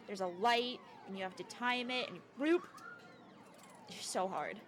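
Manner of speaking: ordinary delivery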